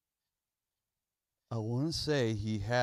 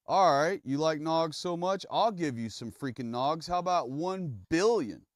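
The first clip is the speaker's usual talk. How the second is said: high, loud sarcastic taunt; mocking God voice; 7 semitones higher, 5 dB louder than usual. God voiced as a sarcastic punisher, high and loud taunting. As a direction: sneer and ramp up the mockery. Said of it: "He goes".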